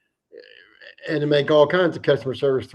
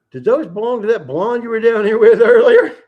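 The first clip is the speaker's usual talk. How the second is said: loud, high, laughing fake-innocent question; butting in on a couple to get them off the dock, sly and loud; mischievous tease; 7 semitones higher, 8 dB louder than usual. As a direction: stir the pot on purpose, grinning. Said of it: "So I up and say".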